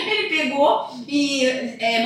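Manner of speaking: ordinary talk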